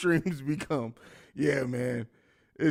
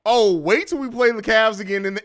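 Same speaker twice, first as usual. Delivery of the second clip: usual speaking voice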